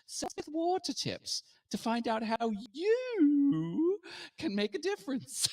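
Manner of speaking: put-on a silly, overdone British accent read, breaking into laughs; horrible British accent. Reading the water ad for the radio host in a mock British accent. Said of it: "with a horrible British accent".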